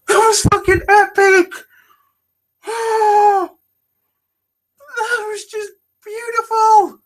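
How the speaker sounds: very high, tearful cry of joy; emotional outburst. Real, not acted. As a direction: squeal it out, overcome and near tears. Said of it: "actually getting a bit emotional".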